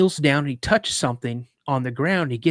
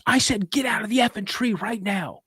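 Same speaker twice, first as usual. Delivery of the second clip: high, forceful imitation of a furious dad; retold angry outburst, pitched way up; angry repeated order; 7 semitones higher than usual. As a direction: order the kid down, out of patience.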